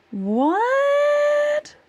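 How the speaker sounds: long, high, loud drawn-out what; stunned disbelief, stretched out loud; surprised. Real, not acted. Streamer sees sub goal jump, high astonished cry.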